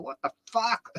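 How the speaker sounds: a high, startled "what the fuck"; shocked outburst. Retold or imitated. Friend discovers the theft outside the shop, high startled outburst. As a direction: retell the shock of finding the stolen goods. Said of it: "I'm going".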